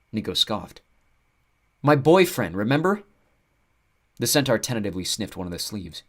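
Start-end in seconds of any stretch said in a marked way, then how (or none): 1.8-3.0 exasperated. Acted. Nico scoffs.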